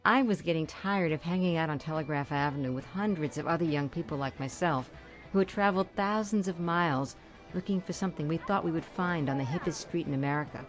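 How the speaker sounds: ordinary delivery, nothing marked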